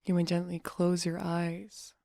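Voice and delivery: soft, unhurried meditation voice; guided meditation. Acted. Meditation coach relaxing listeners' face and jaw, soft guiding voice. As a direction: guide them gently into relaxation, leaving long pauses.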